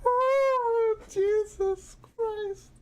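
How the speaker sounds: high cry of laughter, slow; real laugh-cry; wheezing laugh. Real, not acted. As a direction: gasp it out laughing. Crying with laughter.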